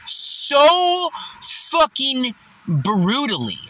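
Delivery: normal talking voice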